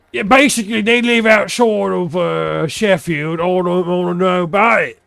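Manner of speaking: put-on a loud, rough go at a Sheffield accent. Imitating someone from Sheffield, high and loud Sheffield accent. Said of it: "That's not even a Sheffield accent".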